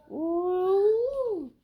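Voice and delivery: a high, loud squealed ooh; sudden delighted squeal; high excited ooh. Real, not acted. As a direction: let out a thrilled, squealing ooh. Kill Bill energy reaction.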